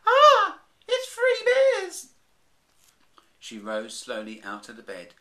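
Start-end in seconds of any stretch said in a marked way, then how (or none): 0.0-2.1 very high, loud, slow shriek; a terrified old lady's scream. Acted. Old lady waking to find bears, terrified scream.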